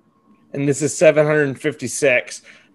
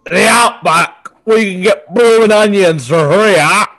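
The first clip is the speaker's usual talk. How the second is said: mock Australian accent; 4 semitones higher, 6 dB louder than usual. Host riffing on the Outback in mock Australian accent. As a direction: lay on a thick fake Aussie twang. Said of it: "That accent change".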